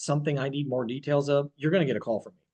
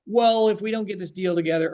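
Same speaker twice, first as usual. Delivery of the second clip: raised, louder sales promise to the buyer; overpromising agent; 6 semitones higher, 5 dB louder than usual. Retold or imitated. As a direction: play the agent brushing off the buyer's worry. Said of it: "how many agents tell a, a buyer".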